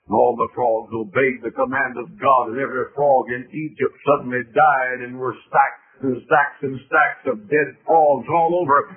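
ordinary talk

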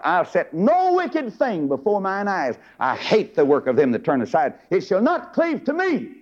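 high, stretched-out pulpit declamation; declaimed vow. Acted. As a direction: proclaim it as a solemn oath, soaring on key words. Preacher declaims David's vow from scripture.

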